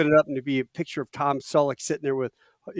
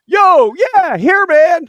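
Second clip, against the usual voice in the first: much higher, loud, laid-back surfer voice; surfer dude; 13 dB louder, slower than usual. Retold or imitated. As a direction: drawl it like a California surfer. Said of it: "and he goes".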